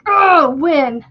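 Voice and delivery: high, loud exasperated groan-question. Retold or imitated. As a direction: blurt it in disbelief. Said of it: "I'm like".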